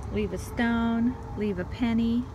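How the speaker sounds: ordinary talk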